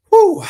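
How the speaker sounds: loud overwhelmed whew; a loud, moved whew; emotional exhale. Real, not acted. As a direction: blow out a loud emotional breath. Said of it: "I don't never get emotional".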